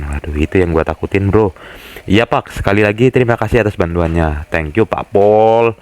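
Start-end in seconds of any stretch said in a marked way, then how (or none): 4.5-5.7 slow, stretched thank you; teasing slow thank-you to the officer; drawn-out thanks. Acted. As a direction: drag out the thanks playfully.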